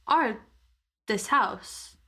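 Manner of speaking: ordinary delivery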